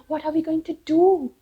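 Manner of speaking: high, loud cry of panic; trapped owls crying out in alarm; panicked owls. Acted. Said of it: "the owls inside in confusion".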